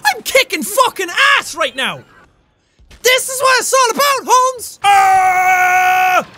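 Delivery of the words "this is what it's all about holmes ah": high, loud victory yell; triumphant shout. Real, not acted. Gamer yelling in triumph at his kill streak, loud victory shout. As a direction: crow over the kills at full voice. Thrilled after multiple kills.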